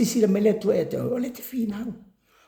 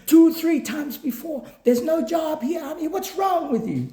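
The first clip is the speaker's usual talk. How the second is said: retold boss yelling; 11 semitones higher than usual. Boss yelling at Ami for coming back again, mock shout. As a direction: shout at her like an exasperated boss. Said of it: "he was screaming and shouting".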